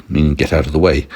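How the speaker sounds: ordinary delivery, nothing marked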